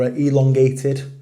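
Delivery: ELONGATED said slowly with lengthened sounds; drawn out. Shown as a demonstration. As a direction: stretch out ELONGATED, slow and loud. Word drawn out to match meaning.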